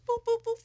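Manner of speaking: high, even hang-up beeps; imitated phone beeps. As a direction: mimic the phone cutting off.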